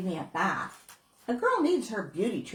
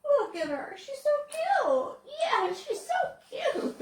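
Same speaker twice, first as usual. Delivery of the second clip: put-on high, slow, giggly little girl cooing over a puppy; little girl gushing. Girl at the shelter gushing over the dog, high and giggly. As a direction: coo like a smitten child, laughing. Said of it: "the girl said".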